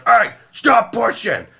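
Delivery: high, drawn-out mock yell; angry complaint. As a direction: imitate an angry woman calling out at a pushy crowd. Angry woman yells at the crowd.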